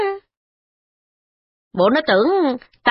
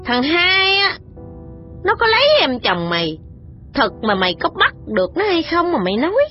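higher, furious matron jabbing a finger; furious threats; 5 semitones higher than usual. A narrator's reading. The matron threatening the servant woman, furious. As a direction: threaten her, building to a cry of insult. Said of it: "điểm mặt Thị Tố mà nói rằng".

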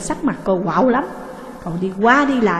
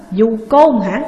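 a loud threatening echo of her insult; menacing retort; 6 dB louder, slower than usual. A narrator's reading. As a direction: bark it back, about to strike. Husband retorts before striking.

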